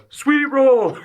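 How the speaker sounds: high, loud drawn-out yell; gleeful yell. Acted. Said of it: "And then I just yell".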